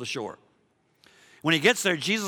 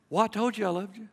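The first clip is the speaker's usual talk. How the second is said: mock-exasperated, pitched up; high, put-upon reply; weary exasperation; 7 semitones higher, faster than usual. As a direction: protest like a pestered spouse. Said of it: "it just kinda gets old after a while".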